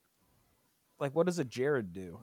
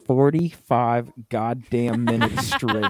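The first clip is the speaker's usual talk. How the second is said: "forty-five goddamn minutes straight" slow and loud, word by word; exasperated emphasis; 2 semitones lower, 8 dB louder, much slower than usual. Real, not acted. Complaining about babysitting the crying kid, "forty-five goddamn minutes straight" loud and drawn out. Stresses how long baby cried.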